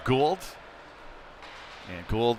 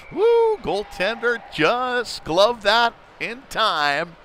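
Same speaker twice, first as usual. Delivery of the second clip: high whoop then slow, high, amazed call; excited whoop; 11 semitones higher, 4 dB louder, much slower than usual. Real, not acted. Announcer reacting to the near goal, excited. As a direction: let out a yelp at the save.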